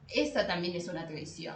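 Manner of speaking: usual speaking voice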